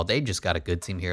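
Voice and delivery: normal talking voice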